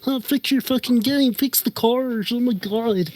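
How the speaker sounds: put-on voice of outraged players piling on pressure; exaggerated, high-pitched mock outrage; mock angry complainers. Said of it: "flack from people who like".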